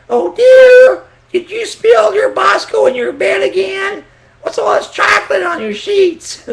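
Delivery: very high, loud falsetto mother finding the sheets; shocked mom. Retold or imitated. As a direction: shriek it like a scandalised mother. Mom voiced finding stained sheets.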